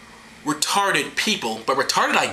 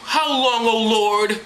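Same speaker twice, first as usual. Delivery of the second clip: high, drawn-out prophet's lament; theatrical pleading call; mock plea; 7 semitones higher, much slower than usual. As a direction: implore the heavens, sustained and high. Isaiah pleads with God.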